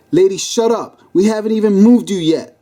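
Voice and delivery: fed-up officer's retort; exasperated cop. Acted. Exasperated cop silencing the lady.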